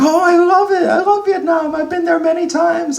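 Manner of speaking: high, loud, gushing praise; gushing traveler. Retold or imitated. Stranger raving about Vietnam in a high gush. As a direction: gush like an eager tourist. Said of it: "they go like".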